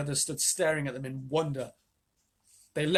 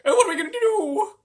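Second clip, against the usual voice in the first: much higher, louder fretting; despairing; 10 dB louder than usual. A narrator's reading. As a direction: cry out helplessly. Grandmother despairing.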